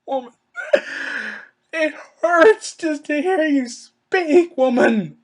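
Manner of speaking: high, slow, loud groan of annoyance; exasperated fan begging for silence; pained exasperation. Real, not acted. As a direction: plead like it physically hurts to listen. Pained complaint about the promo.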